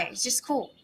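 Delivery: usual speaking voice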